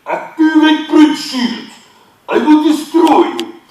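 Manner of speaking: very loud, high, slow villain roar; General Grievous. Retold or imitated. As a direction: draw out each threat like a mechanical warlord. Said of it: "to get his sound".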